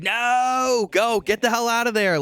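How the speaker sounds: high, drawn-out cry of alarm; exasperated, high-pitched urging; urgent pleading. Retold or imitated. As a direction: yell at the screen for her to run. Viewer urging character to flee.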